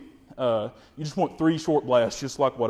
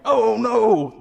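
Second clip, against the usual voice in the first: loud, higher startled cry; alarmed turkey; 7 semitones higher, 8 dB louder than usual. Retold or imitated. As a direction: voice a scared bird squawking. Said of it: "they're like".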